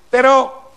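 però said high and loud, sticking out; hesitant but. A narrator's reading. Little man breaking the crowd's agreement, high loud però. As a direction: pop out però high after a meek sì.

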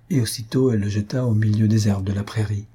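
plain reading voice

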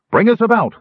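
loud, high command to the crew; urgent shout. A narrator's reading. Sturm ordering gnomes to turn ship, loud urgent shout. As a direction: bark the order before the flock hits. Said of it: "Sturm shouted".

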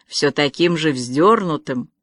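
plain reading voice

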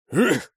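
loud high grunt under impact; effort grunt. A narrator's reading. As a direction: grunt as if taking a heavy hit. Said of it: "крякнул Мол". (крякнуть от натуги)

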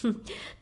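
short, quiet breathy scoff; dismissive puff of laughter; scornful scoff. Acted. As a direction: huff out a cold little laugh. Empress mocks her subordinate.

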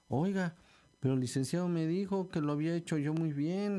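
indignant but polite objection; protesting applicant. Acted. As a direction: plead your case, voice lifted.

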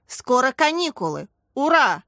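rousing call building to a cheer; loud shout. A narrator's reading. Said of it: "громко крикнул Михаил Борисович".

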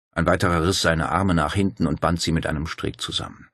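ordinary delivery

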